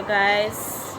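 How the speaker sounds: normal talking voice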